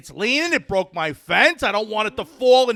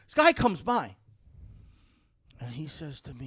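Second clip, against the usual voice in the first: normal talking voice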